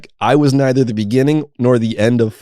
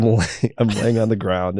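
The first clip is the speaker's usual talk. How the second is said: ordinary delivery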